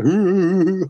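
high, loud, hammed-up vampire ooh; mock vampire wail. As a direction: imitate the vampire's over-the-top moaning cry. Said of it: "starts laughing and making weird noises".

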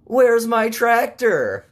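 high, loud, drawn-out holler; shouted punchline. Acted. Farmer who lost his tractor, hammed-up holler.

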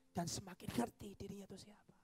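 hushed unvoiced words; whispered teaching. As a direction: let it fall to a whisper of humility. Prophet's quoted teaching.

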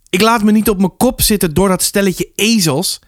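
high, indignant refusal; defiant anger. A narrator's reading. Arnold arguing with his mother, defiant. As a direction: refuse hotly, pitched high.

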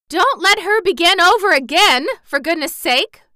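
put-on high, loud pleading old aunt; exasperated cry. Aunt Em begs them to stop the woman, high exasperated cry. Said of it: "cried Aunt Em".

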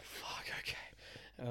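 breathy hushed whisper. Retold or imitated. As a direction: whisper it like a secret. Friend retold, hushed in the dark.

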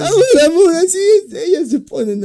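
high, loud crybaby pleading; mock crying plea. Boyfriend begging his angry girlfriend in a fake sob. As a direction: beg her in a fake sob.

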